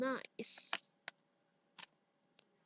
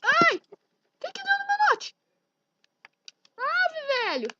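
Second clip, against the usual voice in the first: startled; 10 semitones higher, 6 dB louder, slower than usual. Real, not acted. Laptop suddenly glitches.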